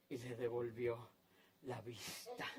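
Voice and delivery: very low and soft, with a pause; quiet, reverent ending; hushed awe. Real, not acted. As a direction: let the miracle land almost in a whisper. Hushed outcome of the miracle.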